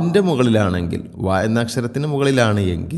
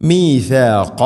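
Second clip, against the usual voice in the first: measured tajweed demonstration, raised and firm; Quranic recitation; 2 semitones higher, 4 dB louder, much slower than usual. Shown as a demonstration. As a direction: recite it as a model for students. Quran teacher recites model word.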